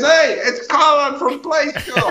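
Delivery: put-on high, laughing kid's call; excited kid. A boy calling to his friends that the Play School host is here, delighted.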